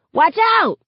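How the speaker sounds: loud, high urgent shout; shouted warning. A narrator's reading. Someone warning of a flying Molotov cocktail, shouting. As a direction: shout a warning across the crowd. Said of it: "Someone shouts".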